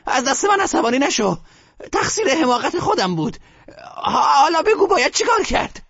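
put-on high old woman, coaxing then fretful; grandmother pleading. A narrator's reading. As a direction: call him back, half scolding. Grandmother calls him back.

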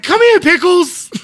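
very high, slow call to a pet; high coaxing voice. Retold or imitated. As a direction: coo it out slowly like calling a puppy. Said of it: "it's like".

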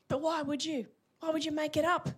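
ordinary talk, nothing marked

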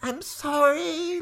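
high, drawn-out wailed apology; shouting. A narrator's reading. Lavender calling to Eliza, high stretched shout. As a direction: cry it out high and slow. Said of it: "Lavender was shouting".

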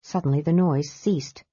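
ordinary delivery

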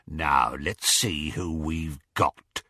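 low, slow gloating growl. Acted. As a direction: relish having caught them. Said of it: "Greyback's gloating voice".

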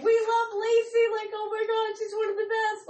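high-pitched imitation of gushing; gushing band reply. Daughter voicing the band's reply to mom, gushing. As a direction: gush it high, as someone else's praise.

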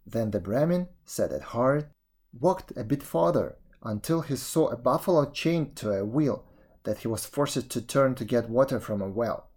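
ordinary delivery, nothing marked

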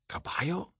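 hoarse, rising croak of a question. Retold or imitated. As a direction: croak the name out, half-voiced. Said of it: "I croaked".

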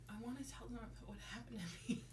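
soft whisper, half laughing. Acted. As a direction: whisper it to the mic. Whispering back into the mic.

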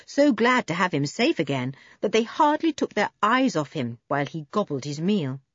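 plain reading voice

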